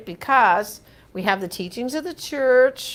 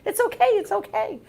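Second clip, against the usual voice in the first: much higher, soothing pretend dog talk; 12 semitones higher than usual. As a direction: voice the dogs comforting mommy. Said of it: "my dogs got on my lap as if to say".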